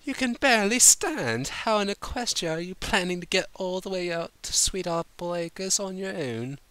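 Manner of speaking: high, refined scold; prim scolding lady. A narrator's reading. Rarity scolding Applejack who can barely stand, prim and exasperated. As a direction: fuss at her like a proper lady.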